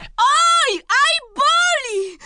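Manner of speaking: high, drawn-out wail of pain; acted cry of pain. Bitten boy crying out over his leg, high pained wail. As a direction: yelp high and stretch out the hurt. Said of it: "Janek krzyczy".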